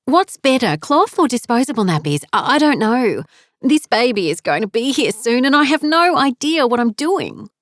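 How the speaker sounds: frantic first-time mother; anxious, panicky. A narrator's reading. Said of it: "Serena's voice was laced with tension".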